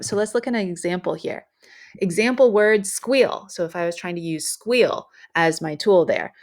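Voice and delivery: ordinary talk, nothing marked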